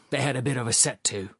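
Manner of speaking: usual speaking voice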